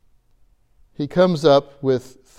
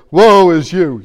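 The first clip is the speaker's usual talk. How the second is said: loud mock condemnation; mock woe; 8 dB louder than usual. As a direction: bark out a mock doom cry. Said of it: "I wouldn't say".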